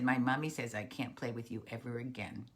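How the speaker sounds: low, subdued goodbye; sad little frog. Acted. Frog tells Snake they can't play, sad. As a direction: say it low and downhearted. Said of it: "little Frog was so sad".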